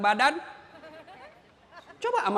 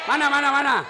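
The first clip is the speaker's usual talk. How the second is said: playful calling out; 3 semitones higher, 4 dB louder, much faster than usual. Real, not acted. Calling out to single girls.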